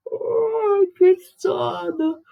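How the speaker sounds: high, slow, yawning sleepiness; drawn-out sleepy moan; sleepy sigh. Shown as a demonstration. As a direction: act out being drowsy.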